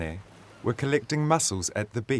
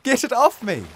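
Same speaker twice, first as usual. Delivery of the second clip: high, loud, desperate plea; frantic cry for help; panicked shout; 13 semitones higher, 6 dB louder than usual. A narrator's reading. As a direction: beg to be freed from the tentacles. Mum continues shouting in panic.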